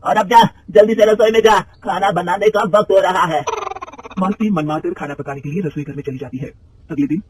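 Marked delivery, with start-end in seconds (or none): 0.0-3.4 loud, high, slow scolding; angry mother-in-law sending her to the kitchen. Acted. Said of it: "गुस्से में कहती है".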